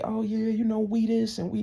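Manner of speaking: high mock of the collector; mocking imitation. Imitating the debt collector's "we" talk, mocking imitation.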